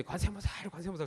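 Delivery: high-pitched cry of 관세음보살 flung at the sky; mock shouting. A narrator's reading. Wife on the roof screaming the prayer at the sky, high and wild. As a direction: holler it out with clothes torn open. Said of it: "소리를 막 질러요".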